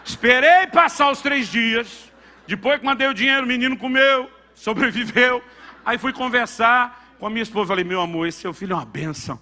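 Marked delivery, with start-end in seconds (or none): 0.1-7.7 loud, high preaching from the pulpit; booming revival preacher; full-force preaching. Acted. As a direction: preach it out with fire.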